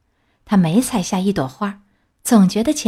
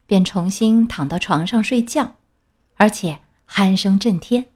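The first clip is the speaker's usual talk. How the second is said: plain reading voice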